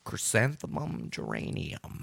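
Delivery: whispering a tongue twister; whispered warmup. Shown as a demonstration. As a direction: breathe the words out unvoiced. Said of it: "I'm all worked out now".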